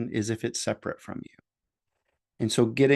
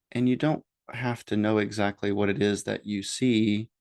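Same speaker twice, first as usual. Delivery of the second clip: ordinary talk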